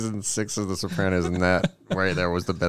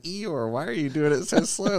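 mock exasperation; 8 semitones higher than usual. Boy boss chiding slow henchman.

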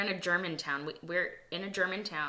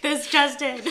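news anchor voice; 9 semitones higher, 9 dB louder, slower than usual. Pretend. Mock news anchor line.

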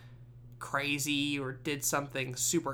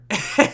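loud, high laugh that breaks out; burst of laughter; 7 semitones higher, 13 dB louder than usual. Real, not acted. Vlogger cracking up at the dog commercial punchline, loud laugh. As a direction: laugh hard at the joke.

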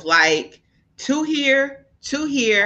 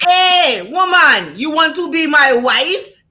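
higher, louder Jamaican suitor impression; mock Jamaican accent; 7 semitones higher, 4 dB louder, slower than usual. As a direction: imitate a Jamaican man calling out a come-on. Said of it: "Killing her fucking Jamaican accent".

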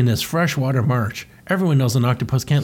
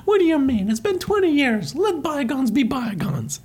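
very high surprised cartoon Mario; Mario's voice; 13 semitones higher than usual. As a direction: act hurt innocence up high. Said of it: "And he goes".

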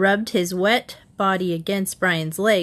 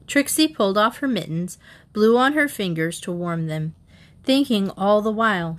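plain reading voice

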